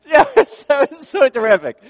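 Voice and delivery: high, loud laughing words. Real, not acted. Laughing at his own story.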